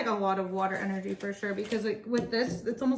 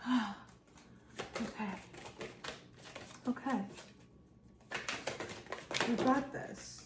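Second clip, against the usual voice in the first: soft sigh, then hushed, spaced-out okays; quiet relief; 11 dB quieter than usual. Acted. Reader voicing someone opening up to that energy, quietly relieved. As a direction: let it out gently, leaving long pauses.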